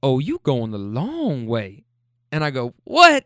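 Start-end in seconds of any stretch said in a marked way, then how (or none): 0.0-1.8 slow, drawn-out granny tease; needling granny. Retold or imitated. Granny needling her driver about the route, slow mock drawl. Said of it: "and she goes". 2.8-3.2 high, loud, incredulous yelp; mock incredulous. Driver challenged by granny, loud high mock disbelief.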